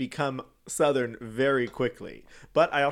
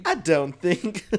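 slow, higher and louder; exaggerated, drawn-out catchphrase delivery; catchphrase impression; 5 semitones higher, 7 dB louder, slower than usual. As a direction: drag out the quote, pushed up.

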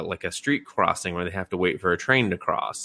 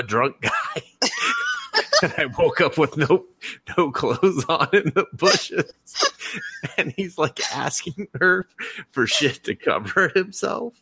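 higher voice breaking up with laughter; laughing while talking; 9 semitones higher than usual. Real, not acted. Retelling the naked man in the bushes, cracking up. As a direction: struggle to get the words out through laughter.

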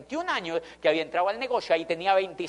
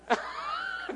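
airy high laugh; 5 dB quieter than usual. Real, not acted. Laughs at his own story.

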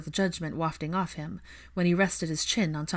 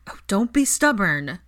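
bossy; 3 semitones higher, 6 dB louder than usual. Acted. Ginny cutting off Hermione.

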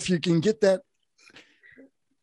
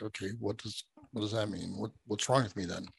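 confused; 9 dB quieter than usual. Retold or imitated. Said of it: "And I'm like".

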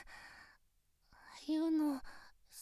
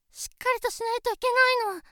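stiff formal greeting; 3 semitones higher, 5 dB louder than usual. Acted.

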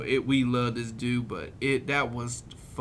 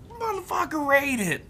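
high mock demand. Teller voices the woman demanding the guy rate it, high. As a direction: squeal the order like the bossy woman.

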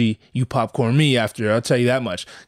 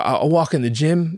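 ordinary delivery

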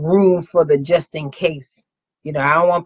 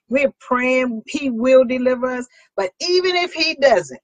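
rally speech; 6 semitones higher than usual. Acted. Preaching at full force.

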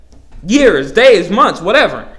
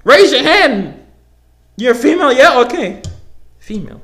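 higher, louder, exasperated challenge; heated rant; 7 semitones higher, 5 dB louder than usual. Real, not acted. Vlogger ranting at viewers who object to the word female, higher and louder. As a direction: press the listener, half laughing in disbelief.

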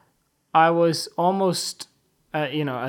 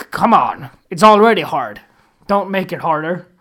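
exasperated plea; 9 dB louder than usual. Retold or imitated. Pleading not to laugh.

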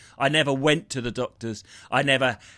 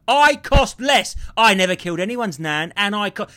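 indignant; 4 semitones higher, 4 dB louder than usual. Real, not acted. Defending himself to co-host over vaccine costs, loudly indignant. Defiant retort in pandemic rant.

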